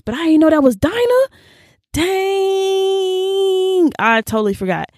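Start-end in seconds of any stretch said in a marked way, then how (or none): 0.0-3.9 high-pitched shock ending in a drawn-out squeal; replayed astonishment, pitch climbing; acted surprise. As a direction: rise high into amazed disbelief. Acting out her surprised discovery.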